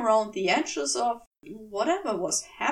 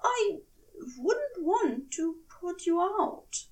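higher, slow coy demurral; demure, a bit too coy; 6 semitones higher than usual. Acted. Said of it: "made it sound more coy than sincere".

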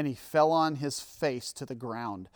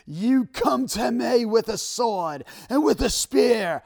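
loud, high, drawn-out Scottish battle cry; hammed-up, badly faked Scottish accent, bellowed slowly; Braveheart Scottish accent; 8 semitones higher, 9 dB louder, slower than usual. Preacher acts out David challenging the Philistine, hammed-up Scottish accent. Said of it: "in the best Braveheart accent that I could possibly muster".

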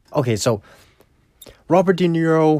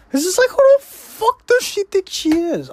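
much higher, indignant outburst; outraged squeal; 4 dB louder than usual. Real, not acted. Viewer fuming at Ginger, high outraged squeal. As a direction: squeak it out in disbelief.

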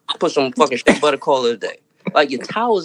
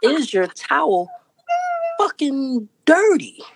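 outraged disbelief; 8 semitones higher than usual. Retold or imitated. Outraged at the dirty towel.

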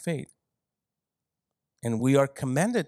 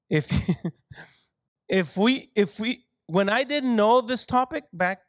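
ordinary talk

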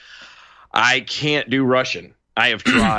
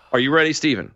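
ordinary talk, nothing marked

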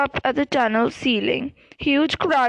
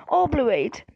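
ordinary delivery

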